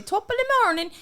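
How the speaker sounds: hammed-up Irish greeting voice; Irish accent. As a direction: put on a stage Irish accent. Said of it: "I gotta remember how I say".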